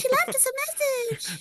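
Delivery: put-on high-pitched excitable girl voice; young girl character. Player voicing the young girl reading the clue, high. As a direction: play the girl, high and eager.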